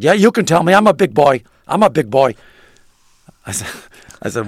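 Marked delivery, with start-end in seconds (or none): 0.0-2.3 louder, higher blustery boss insisting; Steinbrenner. Retold or imitated. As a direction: boom it like a tough old owner. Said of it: "And he said".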